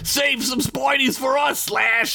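high goblin shout; high yelling goblin. Acted. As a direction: holler it like a rowdy goblin. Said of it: "Slit yelled".

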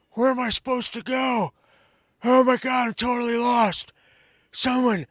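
flustered and lost, fumbling for the teleprompter; hammed-up bewildered president looking for his marker; lost confused Biden. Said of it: "he gets off stage and he goes".

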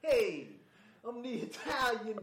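loud, high Italian mobster voice; hammed-up Italian mob boss. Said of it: "more like Commissioner Gordon type".

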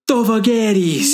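high, ringing proclamation with a laugh in it; imitating a queen commanding her army; dramatic queen command. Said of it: "And then she turns and goes".

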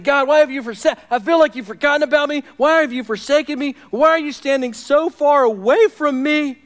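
high, loud, pleading lament; crying out. Acted. Preacher voicing forsaken David to God, crying out. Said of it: "He's crying out".

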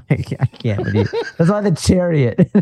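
giggling while talking; words spilling out through laughter; laughing. Real, not acted. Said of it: "I'm trying to keep a straight face".

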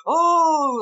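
high, loud excited gasp; a hyped crowd's sudden "oh". Retold or imitated. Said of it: "and they're like".

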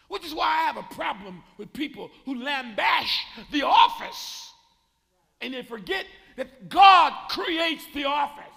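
loud, slow, rising pulpit delivery; building sermon shout; preaching. Acted. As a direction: preach it to the back pew at full force. Preaching at full force.

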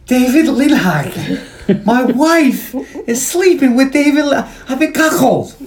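put-on high, slow, drunken laughing wail; drunk Cal. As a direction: lament it like a sloppy drunk at the bar. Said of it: "he's drinking loudly".

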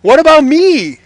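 high, loud, drawn-out begging; loud bitter cry. A narrator's reading. Esau begging his father for a blessing, loud bitter cry. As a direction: plead at full voice, stretching the words. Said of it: "he let out a loud and bitter cry".

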